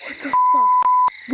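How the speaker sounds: slow, high shriek; terrified scream. Real, not acted. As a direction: shriek it in real fright. Scream at noise outside.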